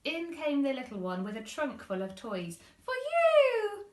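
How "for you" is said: put-on very high, loud delighted toddler; beaming. Little one giving mum toys, high beaming child. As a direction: shout it with joy. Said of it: "he beamed".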